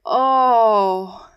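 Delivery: a long, loud "Oh!" stretched across the whole vocal range; prolonged oh. A narrator's reading. Passepartout hears the cost of the voyage, prolonged sweeping loud "Oh!". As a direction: drag the "Oh!" out, sliding through every note you have. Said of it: "he uttered a prolonged".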